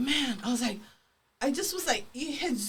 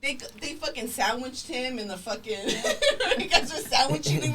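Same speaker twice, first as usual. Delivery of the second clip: usual speaking voice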